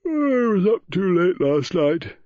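high, loud, slow yawning stretch on the words; words swallowed up in an enormous yawn. A narrator's reading. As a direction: speak through a huge, drawn-out yawn. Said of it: "gave another enormous yawn".

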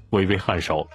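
plain reading voice

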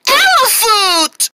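high, drawn-out shout of alarm; startled cry. Acted. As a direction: cry out slowly at a startling sight. Startled cry at seeing elephant.

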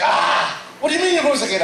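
mock-aghast, pitch shooting up; incredulous. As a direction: imitate shocked listeners demanding an explanation. Listeners' shocked reaction, acted out.

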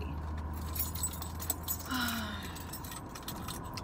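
long unvoiced sigh of frustration; weary sigh. Real, not acted. Fed up with red lights on the way, a long weary sigh. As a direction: let out a long tired sigh. Bad morning, stuck at lights.

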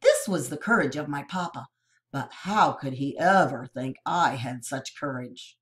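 ordinary delivery, nothing marked

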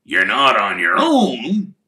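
loud, slow gruff grumble; Hagrid's voice. Acted. Hagrid grumbling about school cabbages, in a loud slow gruff voice.

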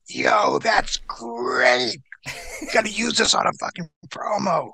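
rough, raspy excited impression; Sandman. Sandman to injured wrestler about a promo, raspy impression. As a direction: rasp it out like a thrilled onlooker. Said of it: "Sandman comes and goes".

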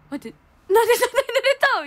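high, quick amazed question; mock surprise. Quoting her friend amazed at how wet she was. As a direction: gasp it out in disbelief.

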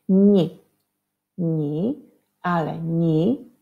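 teacher modelling the sound "ni". Shown as a demonstration. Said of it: "przez i zmiękczone".

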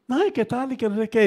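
high, mocking imitation of a coquettish gasp; mock coy exclamation. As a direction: ham up a flirt's coy little cry.

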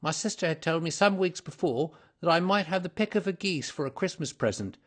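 agitated, high-pitched culprit recounting events; frightened culprit confessing. Acted. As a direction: tell the tale as a nervous confession.

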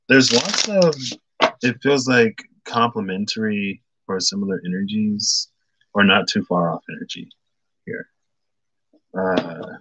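normal talking voice

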